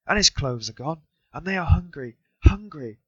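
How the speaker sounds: high, pleading anguished cry; mother cries in despair over her children. A narrator's reading. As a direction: wail for the hungry children. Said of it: "screamed in despair".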